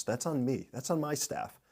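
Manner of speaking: ordinary delivery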